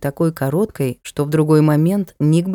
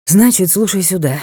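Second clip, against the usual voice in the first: ordinary delivery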